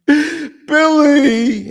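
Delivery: high, loud, sobbing cry of Billy. Retold or imitated. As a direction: wail the name like he's breaking down. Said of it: "He starts crying".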